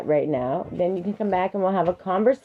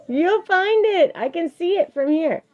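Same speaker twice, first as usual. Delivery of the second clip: raised pitch, parent calling over; 11 semitones higher than usual. Real, not acted. Parent calls across to searching child.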